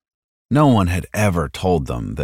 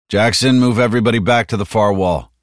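raised, firm command; giving orders; 5 semitones higher, 4 dB louder than usual. Acted. Soldier to his team before uncovering the tarp, commanding. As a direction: order everyone back, a bit louder.

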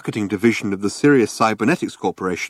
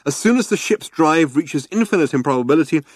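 brochure hype; 6 semitones higher than usual. A narrator's reading.

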